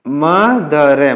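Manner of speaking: मदर drawn out very slowly as "ma, da, ra"; syllable by syllable. Shown as a demonstration. Teacher shows learners how to read it, very slow मदर. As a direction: break मदर into three slow, separate sounds.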